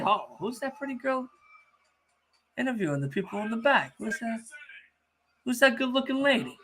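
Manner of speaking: mock flirting. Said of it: "Now you're gonna try to come out here and say".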